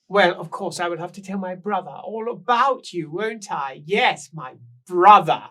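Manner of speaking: high, loud, slow and theatrical; shrill tipsy woman. Acted. Rouged woman on the stairs gushing at the guests, high and loud. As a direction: gush it like a tipsy lady of the house. Woman fussing over brother.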